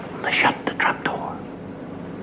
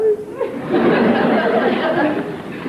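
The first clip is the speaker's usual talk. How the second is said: very high, unvoiced sly cackle. Innkeeper laughs at the fooled traveler, high sly cackle. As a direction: cackle like you know the trick.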